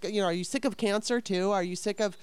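ordinary talk

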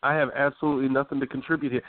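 usual speaking voice

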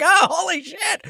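very high, slow comic dread; mock alarm. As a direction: squeal it slowly in hammed-up dismay. Retold alarm at friend's warning.

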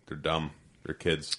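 ordinary delivery

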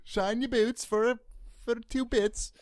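high, soft mock Cockney bootblack; cheeky Cockney hawker, pitched well up. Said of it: "chimney sweep".

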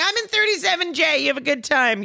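high-pitched comic griping; mock passenger complaint. Economy passenger to a toddler, mock griping.

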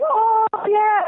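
high whooping cheer, imitating husband; hooting and hollering. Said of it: "he started hooting and hollering".

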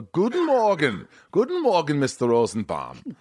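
high, slow cheery German greeting; sing-song German driver greeting; German accent. Retold or imitated. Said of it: "Nico would pick me up and go".

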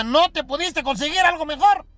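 disapproving mother. Acted. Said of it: "Y dijo la mamá".

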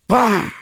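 sudden high-pitched impact noise; imitated punch. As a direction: pop it out as a sound effect for the blow.

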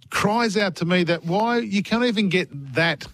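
ordinary delivery

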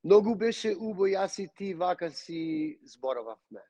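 a fluent Macedonian sentence, natural and easy; now give the same line in Macedonian; spoken in Macedonian. Shown as a demonstration. Guest switching to Macedonian for the host, fluent Macedonian. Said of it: "In Macedonian, it would be".

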